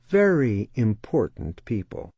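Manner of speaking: normal talking voice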